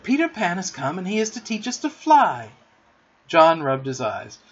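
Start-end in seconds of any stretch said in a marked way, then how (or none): 0.0-2.5 high, excited announcement; eager, breaking-news cry. A narrator's reading. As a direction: announce thrilling news with lifted pitch. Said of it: "she cried".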